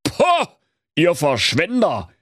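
put-on scoffing old skinflint; growling miser. A narrator's reading. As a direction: huff at the wasteful family. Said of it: "Da knurrte der Knöterich".

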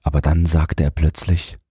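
usual speaking voice

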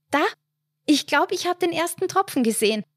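high, fast and delighted; joyful exclaim. A narrator's reading. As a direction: burst out happily at the first raindrop. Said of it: "rief Hildegard freudig aus".

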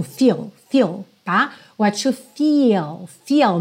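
"feel" held long, never clipped; don't say "feel" short and dry; savoured long vowel. Shown as a demonstration. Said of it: "Saboreia essa vogal". ("feel" longo, sem falar seco)

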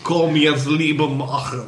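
slow mock Russian giantess; hammed-up Russian Olga voice; russian accent in german. Olga voiced, Siberian frost giantess.

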